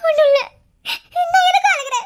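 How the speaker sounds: crying hard, insisting she's fine. Acted. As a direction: wail through the tears. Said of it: "இப்ப அழுதுட்டு இருக்க".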